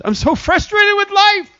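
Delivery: high, put-on whine about life; mock whiny complainer. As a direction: whimper it like a fed-up churchgoer.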